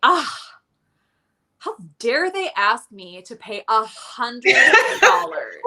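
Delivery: hammed-up outrage, drawn out and laughing; mock indignation. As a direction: play affronted, dragging the words out in disbelief. Acting out her indignation at price.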